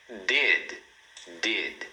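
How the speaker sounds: DID as one syllable, tongue stopping behind upper teeth; clean final D. Shown as a demonstration. Teacher models final D, correct pronunciation. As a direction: say "did" with no vowel after the last D. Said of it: "o D, a gente pronuncia".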